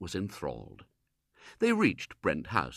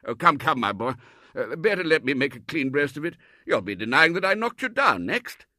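put-on higher, louder hearty old colonel; jovial colonel; 6 semitones higher, 8 dB louder than usual. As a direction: tease him heartily. Colonel voiced.

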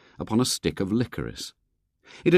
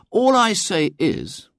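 aggrieved; 11 semitones higher, 4 dB louder, slower than usual. Acted. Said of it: "he said aggrievedly".

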